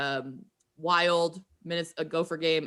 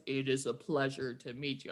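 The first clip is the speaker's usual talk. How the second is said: lower, softer measured star voice; Chris Carter; 4 semitones lower, 6 dB quieter than usual. Retold or imitated. Fan recalling the star's greeting, low and measured. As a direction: imitate his distinctive voice, dropped low and soft. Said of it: "he has that distinctive voice".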